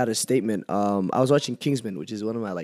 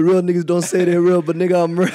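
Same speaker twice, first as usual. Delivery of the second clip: raised, louder mocking voice; mocking impression; 5 semitones higher, 6 dB louder than usual. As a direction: parrot his friend's boast back at him. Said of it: "He said, he said".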